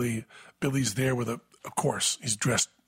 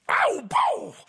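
sudden high mock yelp of pain, louder; imitated pained yelp; 4 dB louder than usual. Billy at dinner yelping as the eye drops sting, mock pained yelp.

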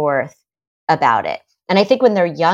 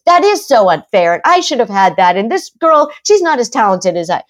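louder, higher wounded outrage at the unfairness; a daughter stoking her own grievance out loud; worked-up daughter; 4 semitones higher, 7 dB louder than usual. Retold or imitated. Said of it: "the daughter gets all worked up about this".

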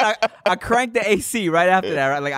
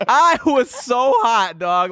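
high, slow, stretched-out moan about the heat; exaggerated complaint; 9 semitones higher, much slower than usual. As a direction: ham up how hot it was. Retelling sweating panic.

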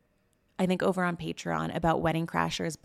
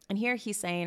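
normal talking voice

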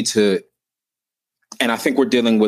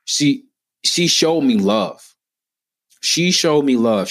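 slow, raised, moved emphasis; heartfelt emphasis; 5 semitones higher, slower than usual. Real, not acted. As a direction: lean into it slowly, full of feeling. Emotional memory of caring principal.

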